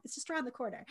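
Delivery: usual speaking voice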